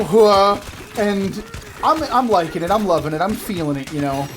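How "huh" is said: loud short "Huh" like a jousting knight; the knights' shout before the call-back; knight's grunt. Retold or imitated. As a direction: punch out one grunted syllable. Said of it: "so they go".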